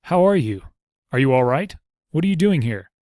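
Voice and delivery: a father firing anxious questions at his daughter; excited worried questions. Acted. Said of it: "he cried".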